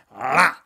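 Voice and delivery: loud gulping ah; loud monster gulp. Acted. Monster swallowing the second bottle, loud gulp.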